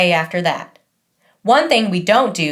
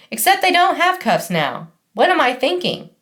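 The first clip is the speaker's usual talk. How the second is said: higher, flustered and self-correcting; a fussy older lady catching herself; flustered grandmother; 7 semitones higher than usual. Retold or imitated. Graham fusses over Max's cuffs, higher and flustered.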